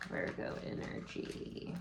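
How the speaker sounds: breathy hushed whisper, almost to herself. Real, not acted. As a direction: murmur it under your breath while reading. Repeats the same words in a whisper.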